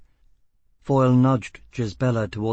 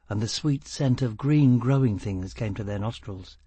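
plain reading voice

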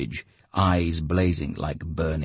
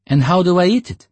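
higher, louder grumpy question; scowling Lyokha; 13 semitones higher, 10 dB louder than usual. A narrator's reading. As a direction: ask with a scowl. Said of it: "Lyokha scowled".

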